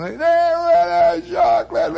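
high, slow screechy mock tantrum; wailing kid. Kid wants a different flavour, wailing tantrum. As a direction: wail like a child demanding ice cream.

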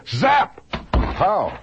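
high, loud comic-book zap and pow; hammed-up cartoon sound effects.

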